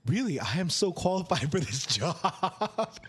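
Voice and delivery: emphatic, giggly self-mockery; laughing protest. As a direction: retell an indignant claim while cracking up. Retold protest to rejecting interviewer, laughing.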